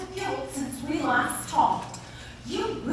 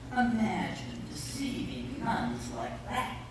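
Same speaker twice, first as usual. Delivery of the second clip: usual speaking voice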